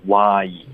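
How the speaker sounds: "哇一" as why, wide ah into ee; open mouth. Shown as a demonstration. Teacher showing student the fix, open-mouthed "哇一". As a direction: drop the jaw for "哇" in "哇一". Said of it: "所以这个一定要张嘴".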